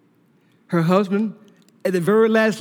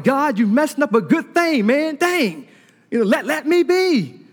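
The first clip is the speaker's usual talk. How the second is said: mock annoyed whine; 5 semitones higher than usual. Complaining to God, mock annoyed.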